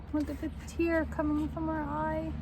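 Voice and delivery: usual speaking voice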